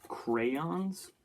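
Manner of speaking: "crayons" stretched out, CRAY-ONS, rising high; over-emphasized crayons. Retold or imitated. Mimicking how people say it, "crayons" as slow, emphatic CRAY-ONS. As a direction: lean hard on both syllables of "crayons". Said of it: "too much emphasis on the entire word".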